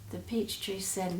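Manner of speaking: ordinary delivery, nothing marked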